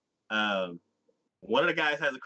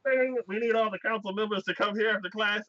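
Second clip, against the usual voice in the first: lightly imitated school PA, higher pitch; PA announcement; 7 semitones higher than usual. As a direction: mimic an announcer calling over the system. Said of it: "over the P A system".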